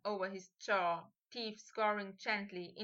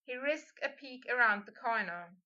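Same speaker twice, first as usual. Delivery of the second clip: plain reading voice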